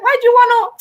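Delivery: high, loud pleading complaint; mock protest. Acts out protest at family watching.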